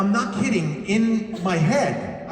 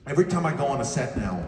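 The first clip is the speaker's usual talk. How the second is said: ordinary delivery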